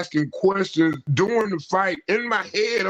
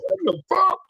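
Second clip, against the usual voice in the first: baffled outburst; 11 semitones higher than usual. Retold or imitated. Retold confusion while being hit.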